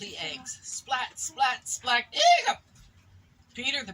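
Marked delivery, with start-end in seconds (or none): 0.6-2.1 punchy sound effect words; loud splat sounds. Acted. Eggs splatting in story. 2.1-2.6 sharp squeaky hiccup sound; loud hiccup. Acted. Piglet hiccups.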